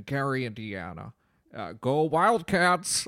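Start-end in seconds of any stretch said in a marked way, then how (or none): 1.7-3.1 loud, higher, drawn-out rallying cry; team cheer. Acted. Professor cheers for the Wildcats, loud drawn-out rallying shout. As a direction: cheer it out slowly and loudly.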